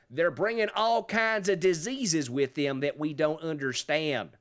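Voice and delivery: slow Southern accent played for stupidity; mock Southern accent. Host quotes the politician on immigrants, in a mock Southern accent. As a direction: draw it out like a dim Southern politician. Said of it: "I am using the Southern accent".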